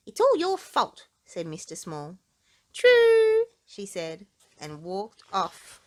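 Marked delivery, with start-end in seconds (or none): 0.1-1.1 high, loud wounded complaint; whiny accusation. Acted. Mister Small to Miss Trouble, a wounded high complaint. 2.7-3.4 very high, loud flippant chirp; careless. Acted. Miss Trouble to bruised Mister Small, a flippant chirp.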